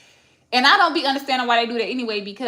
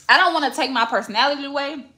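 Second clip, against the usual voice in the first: normal talking voice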